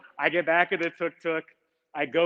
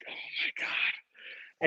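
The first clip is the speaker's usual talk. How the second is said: a breathless oh my God under the breath; whispered dismay; 11 dB quieter, much slower than usual. Retold or imitated. Traveler realizing the train is gone, whispered and slow. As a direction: whisper it slowly, stunned.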